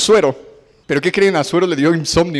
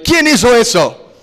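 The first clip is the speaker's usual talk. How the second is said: louder and higher, slow and hard; outraged demand; 7 semitones higher, 5 dB louder, slower than usual. Retold or imitated. The king hearing of the plot against his queen, furious. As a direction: demand the name in fury.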